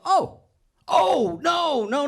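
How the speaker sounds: high, loud backpedalling; high flustered no, no; flustered denial. Retold or imitated. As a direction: scramble to deny it.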